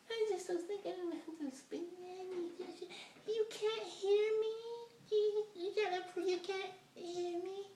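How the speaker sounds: put-on very high, soft mumbled girl voice; mimicking her mumble. Date mumbling her order to the waiter, tiny high voice. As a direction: squeak it out, barely audible. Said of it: "She telling the waiter".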